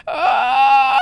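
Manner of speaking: sudden high screech; cracked high shout; breaking falsetto yell. Shown as a demonstration. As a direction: push until the voice breaks high. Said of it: "the more I push, I was going".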